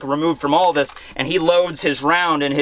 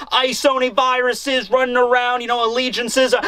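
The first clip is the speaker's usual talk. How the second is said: exasperated, higher-pitched acted rant; hammed-up outrage, as a fed-up fighter; pissed-off rant; 6 semitones higher than usual. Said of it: "Zoro is even more pissed now".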